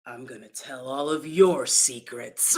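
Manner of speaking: low, quiet mock Johnny. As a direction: drop low and throw it back. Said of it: "He was like".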